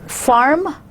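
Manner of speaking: American accent, "farm" with a clear R. Shown as a demonstration. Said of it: "giving the definition to the R, would be American".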